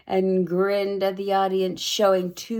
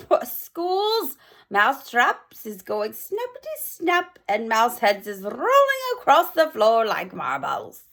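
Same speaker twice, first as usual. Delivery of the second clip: fast, higher cackling witch glee; Grand High Witch gloating to her audience; 5 semitones higher than usual. Acted. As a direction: revel in the chaos like a crone. Witch describes her plan.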